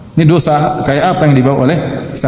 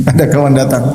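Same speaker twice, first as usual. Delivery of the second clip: normal talking voice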